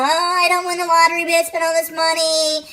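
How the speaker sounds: high, whiny mock hater. Haters whining at a psychic, high mock whine. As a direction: whine it like a sore loser. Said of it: "haters out there".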